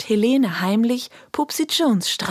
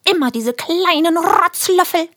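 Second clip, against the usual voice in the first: high, loud scolding teacher; 9 semitones higher, 5 dB louder than usual. Acted. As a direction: wag your finger and scold. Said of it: "ruft schimpfend".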